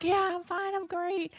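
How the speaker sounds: bright high "I'm fine" to a host; high polite reassurance. Retold or imitated. Polite reassurance to plant manager.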